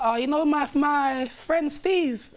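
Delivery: put-on high, slow drawn-out girlfriend voice; mock baby mum voice. Baby mum slowly confessing about Steve, high mock voice. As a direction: stretch it out high and sly.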